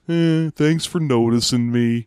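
slow, put-on mopey voice with a sigh-like eh; a lightly voiced mopey Han, thanking her slowly; mopey mumble. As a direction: drag it out like a sad sack who barely cares. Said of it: "his response is".

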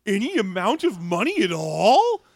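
high, loud incredulous questioning; mock disbelief. Co-host mocking the demand for any money, incredulous. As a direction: stretch the last word up into a loud question.

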